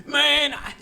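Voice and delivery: put-on angry old dad rant. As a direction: imitate dad ranting about Uber riders. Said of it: "He's like".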